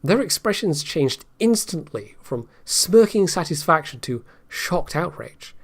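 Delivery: normal talking voice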